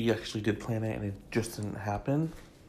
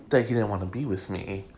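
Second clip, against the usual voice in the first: usual speaking voice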